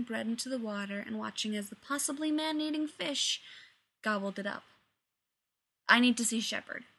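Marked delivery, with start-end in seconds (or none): 5.9-6.8 insistent. A narrator's reading. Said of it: "Rodney insisted".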